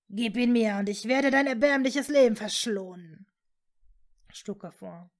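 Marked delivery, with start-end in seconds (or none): 0.1-3.3 loud, imperious dark lord; menacing villain. A narrator's reading. Voldemort demands the wand from Hermine, menacingly.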